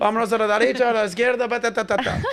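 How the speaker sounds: makeshift Spanish directions with babbled filler; Uber Spanish. Acted. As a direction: rattle off mock Spanish. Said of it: "I speak what is called as Uber, Uber Spanish".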